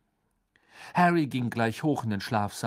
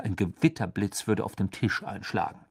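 ordinary delivery

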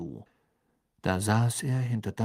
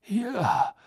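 eager; much slower than usual. A narrator's reading. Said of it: "sagte Karkaroff beflissen".

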